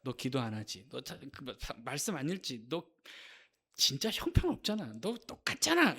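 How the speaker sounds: soft accusing jabs, rising at the end; needling devil. Acted. Satan accusing a believer over prayer, needling. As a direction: pick at a sore spot in mock accusation. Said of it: "사단이 자꾸 이렇게 긁잖아요".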